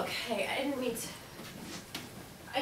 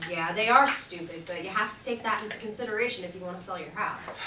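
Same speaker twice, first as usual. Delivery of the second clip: ordinary delivery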